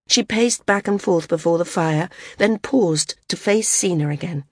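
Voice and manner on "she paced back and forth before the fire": ordinary delivery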